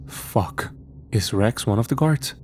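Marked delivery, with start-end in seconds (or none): none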